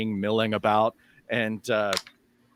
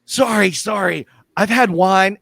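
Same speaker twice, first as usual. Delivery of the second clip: tipsy apology; 6 semitones higher, 8 dB louder than usual. Acted. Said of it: "he's like".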